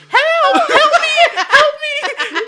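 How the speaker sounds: high, loud mock scream for help; panicked cry for help. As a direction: imitate a trapped friend yelling, half laughing. Retells Devin trapped with the dog.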